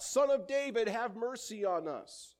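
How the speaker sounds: high, loud cry for mercy; desperate loud plea. Acted. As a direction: call out over the crowd for mercy. Blind men cry out.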